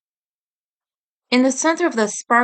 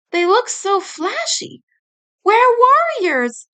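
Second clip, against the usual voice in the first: much higher, slow proud cry; happy exclaiming; 10 semitones higher, 4 dB louder than usual. Acted. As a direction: shout it out with joy. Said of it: "I exclaimed happily".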